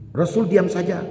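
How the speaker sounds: high, loud declaration; dramatic emphasis. Real, not acted. Preacher telling the congregation the Prophet fell silent, booming. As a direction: punch the line with sudden force.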